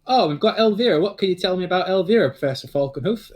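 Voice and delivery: Northern English comic voice. Acted. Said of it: "Larry Guffaw kinda goes".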